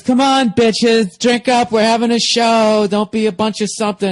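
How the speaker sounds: vamp it up over the mic; drag host voice. Retold or imitated. Comedian mimicking the drag host urging brunch to drink, loud campy. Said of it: "He goes".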